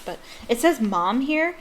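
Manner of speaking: usual speaking voice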